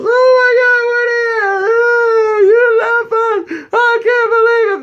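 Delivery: loud, very high mimicked shrieking; squealed hammed-up outrage; mock hysterical girls. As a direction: imitate upset girls wailing. Mimics girls arriving upset.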